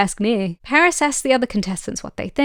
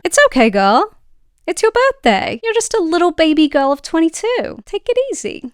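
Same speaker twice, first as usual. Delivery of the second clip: mock baby talk; 6 semitones higher, 4 dB louder than usual. Mocking Paris talking to Kaylee.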